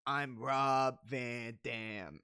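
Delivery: slow, drawn-out, laid-back catchphrase; imitating a wrestler's drawled catchphrase; deadpan catchphrase. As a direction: drag each word out lazily. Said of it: "he just goes".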